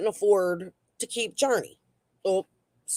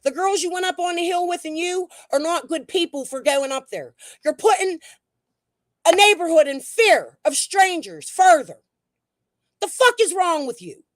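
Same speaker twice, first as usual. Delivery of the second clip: high, loud, accusing outburst; angry rant; 8 semitones higher, 4 dB louder than usual. Real, not acted. Ranting at people who went up the hill, angry and loud. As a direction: tear into them, voice pitched up and hard.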